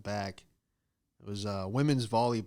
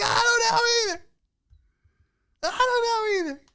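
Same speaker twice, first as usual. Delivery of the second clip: mock sobbing; 8 dB louder than usual. Fake crying to the old man.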